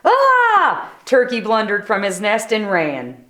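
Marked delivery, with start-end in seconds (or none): 0.0-0.9 higher startled squawk. Acted. Turkey startled from his nest, squawk. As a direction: squawk in fright.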